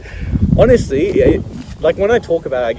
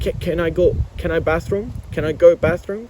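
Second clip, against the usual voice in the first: put-on broken English as a kid; 5 semitones higher than usual. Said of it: "I'd be like".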